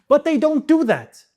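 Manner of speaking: sudden loud, high burst of frustration; loud exasperated protest; exasperated outburst. Real, not acted. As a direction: blurt it out, fed up with the writers. Frustrated outburst in movie review.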